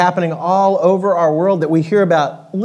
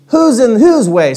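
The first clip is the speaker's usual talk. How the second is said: higher, louder retort; snappish south-going Zax; 6 semitones higher, 6 dB louder than usual. Acted. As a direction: snap back at the rival. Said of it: "snapped the south-going Zax".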